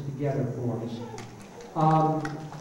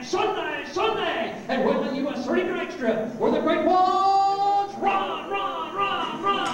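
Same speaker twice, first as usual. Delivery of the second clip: higher, building Sunday Sunday Sunday announcer hype; drag race radio ad; 10 semitones higher than usual. Shown as a demonstration. Replaying his old drag strip commercial for the crowd, hyped announcer. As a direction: sell the drag race like a barker. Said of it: "I will do it in what we call a dry voice".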